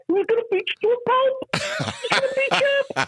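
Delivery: imitating Bruce way up high, a comic squeaky threat; a put-on high Bruce voice making a pulp-beating threat; Bruce impression. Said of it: "that sounds just like Bruce".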